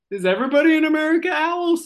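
high, quick, rising acted disbelief; incredulous question. Kenny asking his new owl friends, incredulous. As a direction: blurt it out climbing in pitch.

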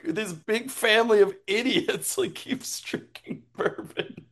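ordinary talk, nothing marked

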